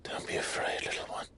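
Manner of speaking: gently calm a frightened little one; soothing. Acted.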